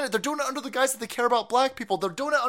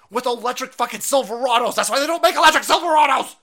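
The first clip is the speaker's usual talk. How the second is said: building to furious yell; 8 dB louder, faster than usual. Real, not acted. Host rants about electric trucks, building to furious yell. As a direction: speed up and explode at the end.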